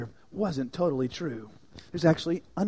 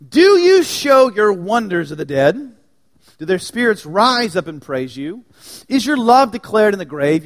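impassioned psalm reading; 5 semitones higher, 12 dB louder than usual. Acted. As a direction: plead the psalm's questions aloud. Reading psalm laments aloud.

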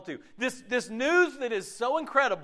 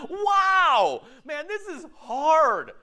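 mock struggle; 8 semitones higher, 6 dB louder, much slower than usual. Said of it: "I'm going".

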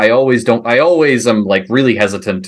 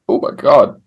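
burping the words. Real, not acted. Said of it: "Sorry, I'm burping a lot".

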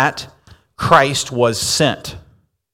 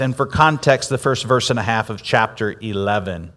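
ordinary talk

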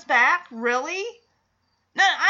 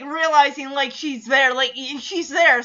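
ordinary talk

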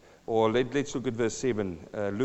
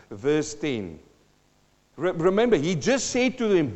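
ordinary delivery

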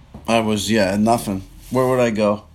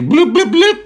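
higher and higher bloop beeps, the last very high; imitated timer beeps; 14 semitones higher, 3 dB louder than usual. Retelling putting money in the car wash, rising bloop beeps. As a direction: mimic the machine, climbing up.

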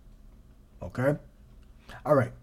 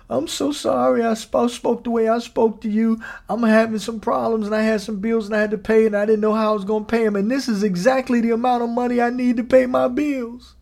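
lightly voiced tearful woman, higher; tearful apology; 8 semitones higher, 3 dB louder than usual. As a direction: apologize through a hint of tears. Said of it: "started crying".

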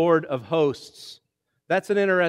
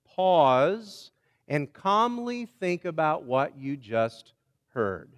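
drawn out slowly, easing the pace; a slow, calm invitation to pause; slower than usual. Shown as a demonstration. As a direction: let each word settle, unhurried.

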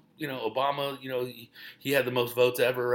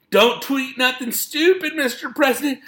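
staffer voice; 11 semitones higher, 8 dB louder than usual. Retold or imitated. Staffers pleading with the president.

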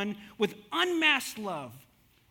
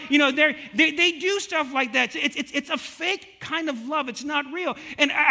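usual speaking voice

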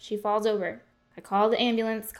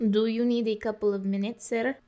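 waitress with a put-on Russian accent; Russian-accented English. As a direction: fake a Russian accent for tips. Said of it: "What does a Russian accent have to".

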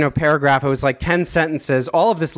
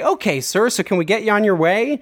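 chirpy salesperson; 8 semitones higher than usual. Retold or imitated. Imitating hardened salesperson.

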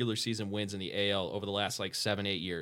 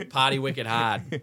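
mock Boston accent; 3 dB louder, slower than usual.

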